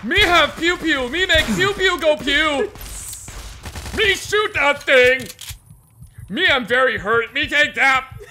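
loud, high caveman babble; dim-witted brute. Acted. Dim companion bragging about shooting, loud and oafish. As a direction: boast like an oversized toddler.

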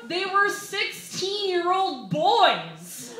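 slow, loud, high indignant rant. Real, not acted. Comic ranting to the crowd about the unfair rule, loud and slow. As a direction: hammer out the outrage.

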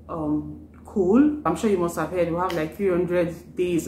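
usual speaking voice

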